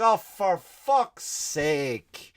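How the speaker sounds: slow, drawn-out high whine of despair; weary exasperated drawl; exasperated groan. Retold or imitated. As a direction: moan it like the night's ruined. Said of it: "I was like".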